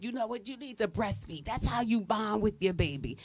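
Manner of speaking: put-on older women advising a new mother, softly; hushed advice. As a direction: pass on advice quietly. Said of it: "they was like".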